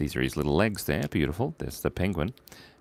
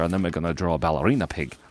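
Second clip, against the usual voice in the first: ordinary talk, nothing marked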